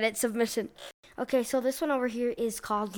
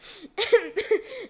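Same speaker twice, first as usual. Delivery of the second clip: giggle; 8 semitones higher, 3 dB louder than usual. Real, not acted. Laughing about the story.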